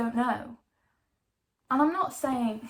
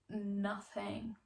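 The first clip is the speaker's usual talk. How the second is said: ordinary delivery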